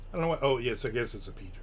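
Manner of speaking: ordinary talk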